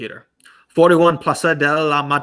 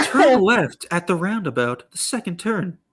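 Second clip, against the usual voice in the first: gps voice; 6 semitones higher than usual. Shown as a demonstration. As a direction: read it like a car's sat-nav. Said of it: "I make a great G P S voice".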